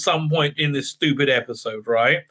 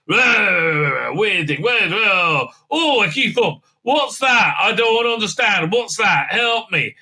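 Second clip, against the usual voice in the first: high, hammed-up bewilderment; mock confused Doctor; 3 semitones higher, slower than usual. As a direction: play her baffled and helpless, pitched up.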